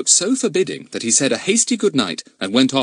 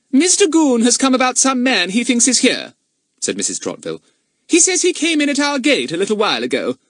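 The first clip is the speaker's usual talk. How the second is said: high, loud stern lady; haughty mistress of the house; 4 dB louder than usual. Acted. Narrator voices Mrs Trotville.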